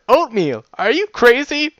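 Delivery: high, loud, incredulous punchline; hammed-up incredulous storyteller; joke punchline. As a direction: deliver the punchline at full tilt, pitch soaring. Acted punchline told amid laughter.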